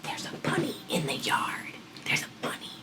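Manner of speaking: hushed, breathy-free whisper of "There's a bunny"; excited whisper. Retold or imitated. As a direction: whisper so the dog won't hear. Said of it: "I didn't wanna yell".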